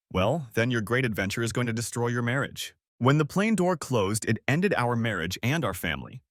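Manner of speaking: usual speaking voice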